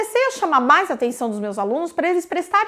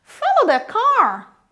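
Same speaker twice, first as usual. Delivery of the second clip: "car" pitched high with a falling tail; rise on CAR; 6 semitones higher, slower than usual. Shown as a demonstration. Teacher repeats the melody demo, rise on car. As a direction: slowly climb onto "car". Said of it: "eu subo no car".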